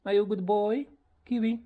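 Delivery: high cooing question; pet talk. Real, not acted. Owner asking parrot if he's good boy, high pet talk.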